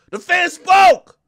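high, loud and slow, spoke hammered; slow loud proclamation. Real, not acted. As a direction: declare it slowly and loudly. Emphatic declaration.

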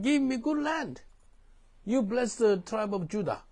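high, loud mock complaint; whiny complaint. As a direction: whine like a grumbling tribe. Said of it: "That kind of attitude".

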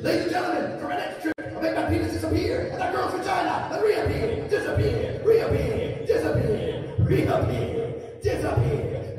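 very high showman patter; perverted magician. Acted. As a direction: announce a lewd trick as a stage magician. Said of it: "My impression of a perverted magician".

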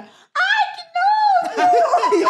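very high, drawn-out squeal of disgust; mock disgusted squeal. Girl reacting to the filthy bathroom, disgusted squeal. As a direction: squeal it high and long, hamming up the revulsion.

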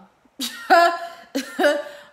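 loud, high burst of laughter; big real laugh. Real, not acted. Laughs after saying she's loud.

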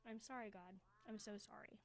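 soft, subdued apology to God; hushed, understated regret; remorseful prayer. A narrator's reading. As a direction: drop the voice and confess sorrowfully. Boy's remorseful prayer ends chapter.

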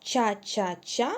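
each syllable spaced out slowly; slow, deliberate syllables; slow syllable drill. Shown as a demonstration. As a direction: spell the rhyme out slowly for children. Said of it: "Повторіть, будь ласка".

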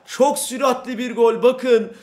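usual speaking voice

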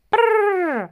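high, loud horse-stopping trill; lip-trilled prrr to halt a horse. A narrator's reading. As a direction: rein in the horse with a brrr. Carter stops his horse.

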